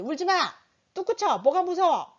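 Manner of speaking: a hint of a sharp scolding mother; scolding mom. Shown as a demonstration. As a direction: snap at the child to stop crying. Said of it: "다른 엄마한테". (아이를 다그치는 엄마)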